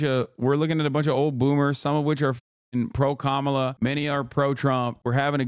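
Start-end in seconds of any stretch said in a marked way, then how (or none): none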